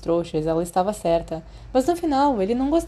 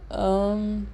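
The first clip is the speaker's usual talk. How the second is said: plain reading voice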